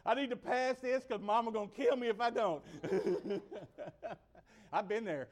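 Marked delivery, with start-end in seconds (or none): 0.1-2.6 loud, high comic pleading; mock-frantic prayer; desperate praying kid. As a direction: plead like a panicked student, hammed up.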